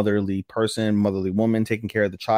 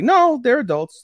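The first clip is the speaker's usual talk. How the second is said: high insistent; 13 semitones higher, 6 dB louder than usual. Retold or imitated. Komori voiced insisting.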